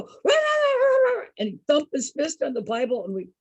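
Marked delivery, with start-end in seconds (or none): 0.0-1.2 high, loud, hammed-up preacher ranting; mock preaching shout. Imitating the host thumping his Bible and preaching at his guests. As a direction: pound out gibberish like a fiery preacher. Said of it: "and then he'd go".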